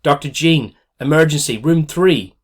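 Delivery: calling out. Acted. Nurse calling the doctor for an emergency, urgent call. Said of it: "calling out".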